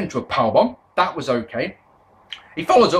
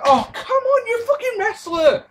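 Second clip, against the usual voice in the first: exasperated. Retold or imitated. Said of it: "it's just like".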